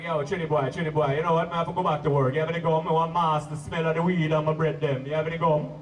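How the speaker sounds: low Jamaican patois voice of a friend. Retold or imitated. Jamaican friend begs comic for gum, low Jamaican accent. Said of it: "he looks at me and he's like".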